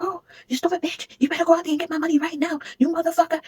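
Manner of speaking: high, shrill-pitched mock scream of a furious mother; imitated high furious yelling; imitating angry yelling. Mother cursing, overheard through door.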